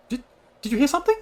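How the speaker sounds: high, fast startled question; suddenly alarmed NPC; startled speaker. Acted.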